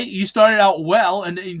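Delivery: ordinary talk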